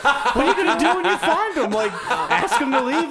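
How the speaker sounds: high, laughing mock exasperation; mock exasperated rant. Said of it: "I'm like".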